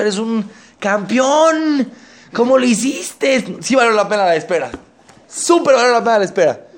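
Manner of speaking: high, slow, drawn-out praise; wife gushing over her husband's diamonds; thrilled wife. Acted. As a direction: savour every word of the compliment.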